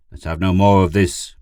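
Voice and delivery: loud, stern reprimand; angry. A narrator's reading. Pid reprimands Gur, angry. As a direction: put your foot down, loudly. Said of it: "Pid said angrily".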